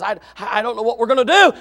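loud, high, stammering alarm; hammed-up frightened servant; panicked servant. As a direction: blurt it to the preacher in a panic. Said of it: "The servant comes to the preacher".

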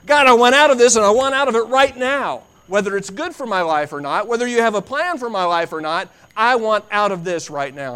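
high, loud insistent demand; demanding prayer. Acted. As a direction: demand rescue right now. Desperate prayer voiced.

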